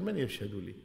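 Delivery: low, soft retold aside to oneself; imitating talking to himself. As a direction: say it under your voice, as if only to yourself. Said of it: "يقوم وينكر على نفسه". (يحاكي من يحدّث نفسه بصوت خافت)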